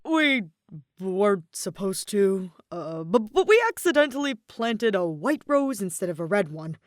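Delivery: loud, fumbling, stammered admission; embarrassed guard owning up; sheepish soldier. A narrator's reading. Card soldier confessing to Aether, sheepish and loud. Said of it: "let out a sheepish grin".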